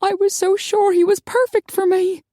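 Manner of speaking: high, heartbroken lament; tearful. A narrator's reading. Crying woman mourning her ideal man to friends, tearful. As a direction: lament it high, close to tears. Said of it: "New tears tumbled down Maggie's face".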